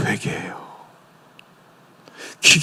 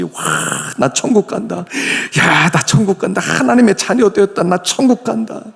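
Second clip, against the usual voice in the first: high excited cheer; joyful shout; 5 semitones higher than usual. Retold or imitated. Believer cheering that he is going to heaven, high excited. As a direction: celebrate like a child going to heaven. Jumping for joy about heaven.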